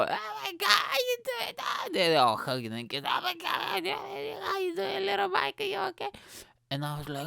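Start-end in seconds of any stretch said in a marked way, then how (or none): 0.0-6.1 very high, gushing mock aunt voice; imitating gushing aunts. Aunts fussing over little Mike, high gushing mock voice. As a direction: squeal it up high like doting aunts.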